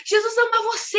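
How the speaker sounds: put-on high, excited child's call; imitated street shouting. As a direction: call out joyfully like a girl in the street. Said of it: "começou a gritar na rua".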